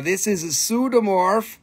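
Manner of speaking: usual speaking voice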